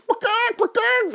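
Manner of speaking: very high squawking clucks; imitated chickens. Storyteller imitating the caught chickens, high squawk. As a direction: squawk it like startled poultry.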